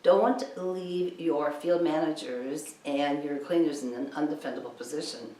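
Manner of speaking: ordinary talk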